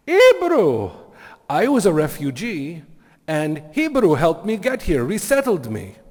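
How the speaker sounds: loud, high, excited Venezuelan imitation; botched Venezuelan accent. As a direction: exclaim it in a hammed-up Venezuelan accent. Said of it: "really butcher his accent".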